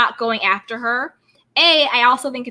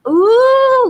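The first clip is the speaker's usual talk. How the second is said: high, loud delighted ooh; a thrilled, high ooh; excited squeal; 11 semitones higher, 9 dB louder than usual. Real, not acted. Excited at a caller coming in.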